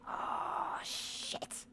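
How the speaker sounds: soft voiceless oh shit; whispered curse. Real, not acted. Streamer spotting danger, whispered curse. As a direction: breathe the curse out in alarm.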